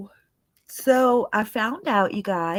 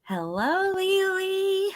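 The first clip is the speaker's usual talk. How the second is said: much higher, sing-song hello; high-pitched greeting; 12 semitones higher, slower than usual. Pretend. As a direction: greet her in a squeaky high voice. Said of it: "now I'm back to myself".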